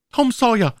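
put-on high, loud girl's cry; Becky crying out. A narrator's reading. Becky calls Tom out in anger and shame, high cry. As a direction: call his name in angry shame. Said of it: "rief sie".

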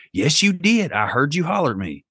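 a hint of a stubborn small daughter; father imitating his daughter insisting; child's voice. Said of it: "she was like".

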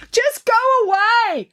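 high, drawn-out shout; high, petulant yell to be left alone; called from inside. A narrator's reading. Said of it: "called the muffled voice from inside".

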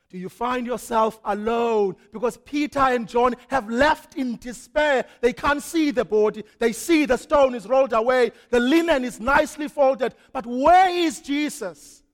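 loud, rising, slowing into the question; full-force preaching. Acted. Preacher asking the grieving congregation where Jesus is, loud and building. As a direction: press the congregation harder each line.

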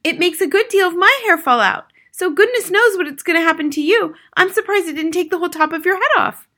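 high, loud scolding mother. A narrator's reading. Mother warns husband of hair loss, high and emphatic. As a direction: pile on the alarm in a high voice.

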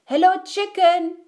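high, loud greeting; Duck calling out. A narrator's reading. Duck greets Chicken while riding, calling out high. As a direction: hail someone brightly up high as Duck.

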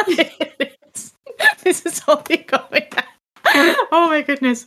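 laughing through the words, slow. Real, not acted. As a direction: giggle out the lines. Laughing at tragedy.

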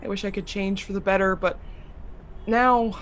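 soft, low, hopeless; quiet despair. Acted. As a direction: murmur regret softly.

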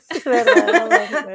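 laughing loudly. Real, not acted. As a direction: laugh through the words. Laughing reaction.

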